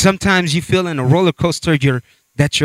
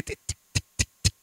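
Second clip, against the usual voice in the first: whispered ticking; 14 dB quieter, much faster than usual. Retold or imitated. Imitating coaster clicking.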